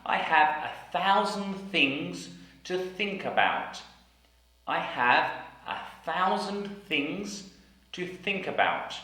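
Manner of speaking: I have a thousand things, each th clear; a thousand things, th stressed; careful th sentence. Shown as a demonstration. Teacher modelling the thousand things sentence. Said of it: "sentences with the T H sound".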